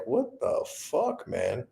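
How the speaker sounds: bewildered. Retold or imitated. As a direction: half-whisper your disbelief at the scene. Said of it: "it's just like".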